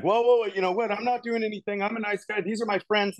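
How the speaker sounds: high, protesting imitation of a panicking man; mock panic. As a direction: plead innocence in a high, alarmed voice. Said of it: "he's going into full on panic mode".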